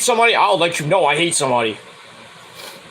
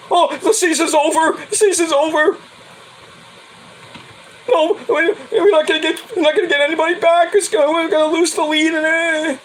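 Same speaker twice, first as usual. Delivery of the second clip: whiny wannabe fan; 9 semitones higher than usual. Retold or imitated. Vlogger mocking complaining fans, high whine. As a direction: ham up the doom-saying fan. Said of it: "and you're complaining".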